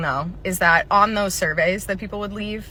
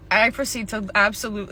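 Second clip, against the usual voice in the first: usual speaking voice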